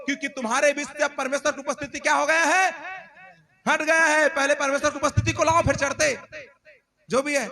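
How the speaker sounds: high, loud, urgent commands; repeated shouting. Acted. Moses to people climbing without God's presence, repeated shouting. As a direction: shout as a prophet trying to halt the runaways. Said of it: "मूसा चिल्ला चिल्ला कर बोल रहा है".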